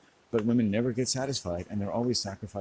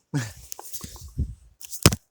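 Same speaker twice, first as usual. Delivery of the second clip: a voiceless, louder cackle; breathy manic laugh; 3 semitones higher, 6 dB louder than usual. Real, not acted. Vlogger cackling after his shout.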